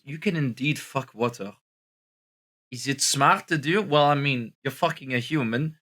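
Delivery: hammed-up French accent on English; put-on French accent. French persona greeting his viewers, in a put-on French accent. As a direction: play a tired Frenchman mangling English. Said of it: "this French accent".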